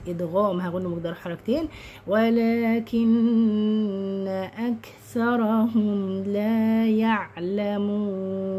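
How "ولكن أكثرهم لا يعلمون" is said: slow, drawn-out tajweed chant; Quran recitation. Shown as a demonstration. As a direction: recite with long held vowels and nasals.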